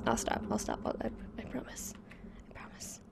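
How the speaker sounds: whispered promise. Real, not acted. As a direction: breathe it in a whisper.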